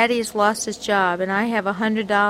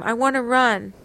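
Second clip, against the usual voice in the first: ordinary delivery, nothing marked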